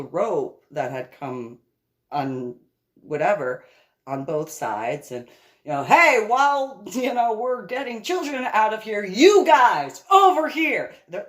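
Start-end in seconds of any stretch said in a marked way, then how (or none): 5.8-10.9 loud, high commands; shouted directions. Retold or imitated. Directing soldiers while children are pulled out, shouting. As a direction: take charge over the noise.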